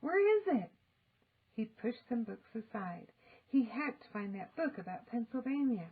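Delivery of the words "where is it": loud, high frantic question. A narrator's reading. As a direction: ask in a panic. Frantic search for book.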